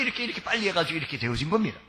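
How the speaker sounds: fast nervous stammered explanation; hurried excuse. Acted. Jacob hurriedly explaining the quick hunt to father. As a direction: rattle off the lie quickly.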